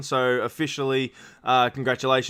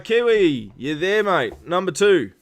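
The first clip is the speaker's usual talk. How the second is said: raised voice, calling across to a racer on the call; 4 semitones higher, 6 dB louder, slower than usual. Real, not acted. Host calls out to silent racer Kiwi, raised voice. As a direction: call out to get his attention.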